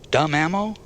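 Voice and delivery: rising, curious repeat; puzzled question. A narrator's reading. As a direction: ask it back, puzzled. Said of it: "Jerry asked".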